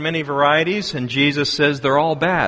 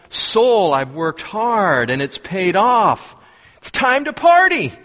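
gleeful farmer; 4 semitones higher than usual. Acted. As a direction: build to a whoop on the party line. Farmer talks to his soul.

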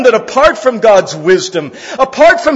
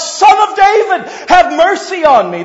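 high, loud, slowed cry for mercy; a beggar's loud plea, pitched high; 8 semitones higher, 4 dB louder than usual. Retold or imitated. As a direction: call out over a crowd to someone far off. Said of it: "He just cried out".

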